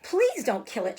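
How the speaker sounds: mild sob. Acted. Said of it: "she sobbed".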